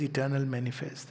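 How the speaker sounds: usual speaking voice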